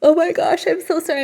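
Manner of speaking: talking through a yawn; stretched out by a yawn. Real, not acted. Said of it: "I'm yawning".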